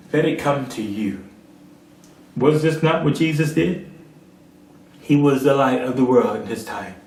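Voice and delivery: ordinary delivery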